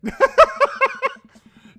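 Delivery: loud high burst of laughter. Real, not acted. Player cracking up at the scene, loud high laugh. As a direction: crack up loudly.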